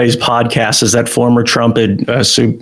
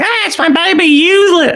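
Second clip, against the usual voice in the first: high-pitched mock Cockney accent, hammed up; a Cockney mum parodied in a squeaky voice. Mocking a mall mum, doing a high squeaky Cockney accent. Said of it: "I don't know why they have a Cockney accent".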